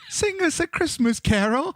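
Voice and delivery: put-on high-pitched little girl carol request; imitating small children's high voices. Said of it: "three little girls and go".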